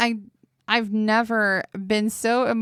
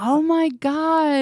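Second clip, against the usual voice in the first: drawn-out oh my god; 7 semitones higher, slower than usual. Real, not acted. Reacting to retold story.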